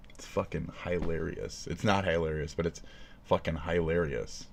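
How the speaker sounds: low, soft, straight-faced delivery; quiet, unsmiling irony; deadpan sarcasm. Real, not acted. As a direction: say it drily with no hint of a joke. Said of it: "I said that with a dead face".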